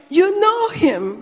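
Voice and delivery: stunned delight at a discovery; amazed excitement. Retold or imitated. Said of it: "And he got so excited".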